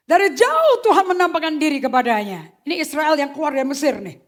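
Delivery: loud, high, impassioned preaching; fervent pulpit preaching; full-force preaching. Acted. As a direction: preach it loud to the whole church.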